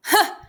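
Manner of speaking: sharp high scoffing laugh; short bitter laugh. A narrator's reading. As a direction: bark out one harsh laugh. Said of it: "Crooks laughed again".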